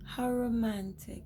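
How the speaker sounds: high, soft, dreamy sigh of romance; swooning. Pretend. Watching a wedding and swooning softly.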